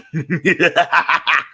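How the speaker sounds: high staccato mad laugh; insane villain cackle. Shown as a demonstration. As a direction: cackle like a cracked clown. Demonstrating the insane Master's laugh.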